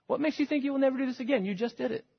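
high, fast, incredulous question; incredulous challenge. Real, not acted. Preacher challenging the congregation, high fast disbelief. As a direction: fire it back in disbelief.

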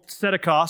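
tzedakah stressed on its first syllable; TSEH-da-kah, weight up front in "tzedakah". Shown as a demonstration. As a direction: punch the opening syllable of "tzedakah". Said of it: "The emphasis is on the first syllable".

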